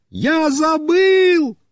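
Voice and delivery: slow, high drawn-out shout; high stretched yell; calling back. A narrator's reading. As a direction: holler it back across the yard. Shouted exchange with the rider.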